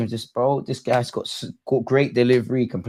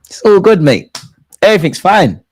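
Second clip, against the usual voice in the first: mock reassurance; 6 semitones higher, 9 dB louder than usual. Real, not acted. Forced calm after a goal.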